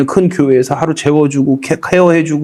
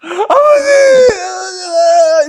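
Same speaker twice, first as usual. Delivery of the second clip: high, drawn-out crying call; high, slow, tearful cry of Father; anguished cry to God; much slower than usual. Retold or imitated. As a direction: wail it upward, slowly, overcome. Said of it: "이러고 있는데".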